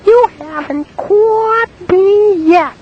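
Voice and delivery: very high, slow singsong taunt; a prank caller taunting the woman in falsetto. Retold or imitated. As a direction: tease her in a squeaky falsetto. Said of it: "Yeah, like that".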